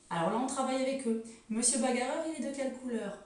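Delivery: usual speaking voice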